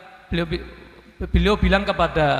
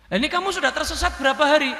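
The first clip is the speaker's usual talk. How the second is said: ordinary talk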